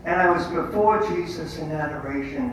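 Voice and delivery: ordinary talk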